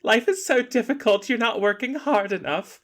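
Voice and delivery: high, whiny put-upon voice; mock dainty complaint. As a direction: whine it like a pampered princess. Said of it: "and she's like".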